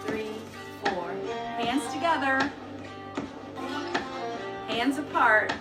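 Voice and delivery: usual speaking voice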